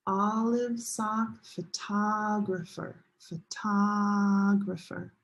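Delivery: slow, with long "OL", "SOCK" and "TOG" in "photographer"; drawn-out stress. Shown as a demonstration. Teacher modelling hand practice, slow stretched stress on "photographer". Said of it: "So try it".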